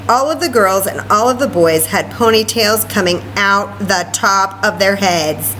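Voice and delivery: usual speaking voice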